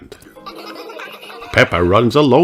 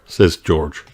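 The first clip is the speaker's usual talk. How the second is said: plain reading voice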